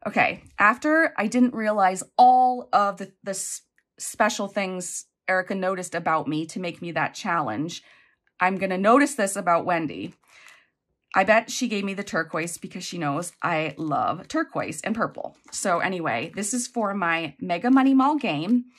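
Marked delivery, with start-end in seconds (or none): none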